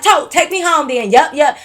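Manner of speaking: mock yell. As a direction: snap it out like a huffy friend. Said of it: "like, yelling".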